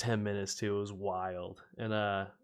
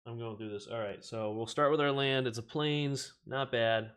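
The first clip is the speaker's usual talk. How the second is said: usual speaking voice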